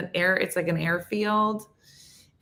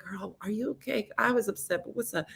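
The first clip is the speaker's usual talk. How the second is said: softer, higher, gentle check-in; soft concern; 4 semitones higher, 9 dB quieter than usual. Pretend. Calling Cheryl's crying line, softer and concerned. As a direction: coax a crying friend.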